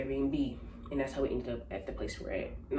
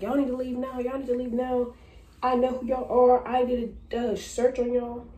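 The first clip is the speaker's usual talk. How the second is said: high, raised mock threat; retold angry order; 9 semitones higher, 6 dB louder than usual. Woman with gun ordering tenants out, high mock-angry demand.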